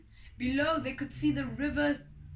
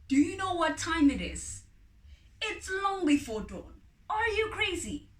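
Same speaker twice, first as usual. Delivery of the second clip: exasperated; 7 semitones higher, 6 dB louder than usual. Acted. Farmer woken before dawn.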